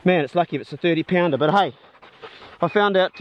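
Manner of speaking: ordinary talk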